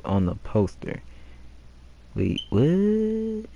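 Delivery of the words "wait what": startled. Real, not acted. Player startled reading a poster.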